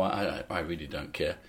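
deadpan. Retold or imitated. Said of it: "deadpan follow-up to greeting".

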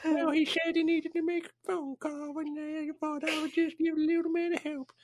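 character voice. Acted. S1 plays a character in a sketch.

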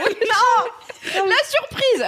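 very high, slow laughing protest. Retold or imitated. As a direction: protest through giggles. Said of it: "J'étais là". (protestation en riant)

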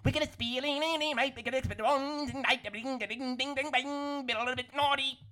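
high garbled gibberish singing; Donnie from the Wild Thornberrys. Retold or imitated. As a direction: mangle the lyrics into babble, sung high. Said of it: "sounds like Donnie from the Wild Thornberrys".

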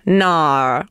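low Australian "no", drawn out as "nar"; Australian accent. Shown as a demonstration. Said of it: "And here's Australian".

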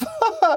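a high, hammed-up laugh; a squeaky showpiece giggle; mock laugh on request. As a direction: burst out in a put-on laugh to show it off. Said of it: "Can you give us a bit?".

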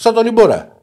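high, loud indignant retort; worked up. Real, not acted. Co-host compares his height to a player's, loud and worked up. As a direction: throw it back high and loud. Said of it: "Τι έπαθες με το Χουάνκ".

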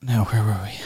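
quick unvoiced whisper. Real, not acted. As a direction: breathe it under your breath. Aside to himself.

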